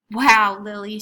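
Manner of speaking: a big, loud "Wow" of delight; be bowled over by the garden; admiring wow. Acted. Mrs Chan marvels at Lily's garden, loud delight.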